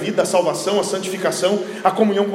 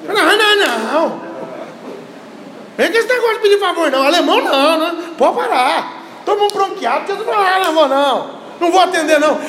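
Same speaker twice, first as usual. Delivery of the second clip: indignant refusal; 8 semitones higher than usual. Retold or imitated. Brazilian refusing a German's favour after the seven-one, mock outrage. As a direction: play a fed-up Brazilian turning a German away. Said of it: "Vinha um alemão pedir um favor".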